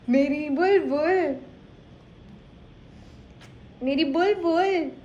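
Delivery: high sing-song cooing to her cat; cooing pet talk. Real, not acted. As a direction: coo sweet names at her pet.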